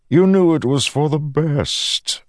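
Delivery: lightly voiced menacing god; a hint of a smooth villain; Odium voice.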